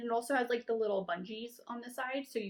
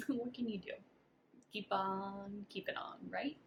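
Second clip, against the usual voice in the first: resigned whisper; 5 dB quieter than usual. Real, not acted. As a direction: say it softly, shrugging it off. Resigned pep talk.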